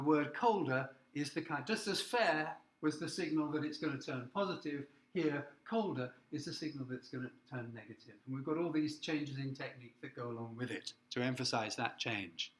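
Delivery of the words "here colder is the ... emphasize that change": usual speaking voice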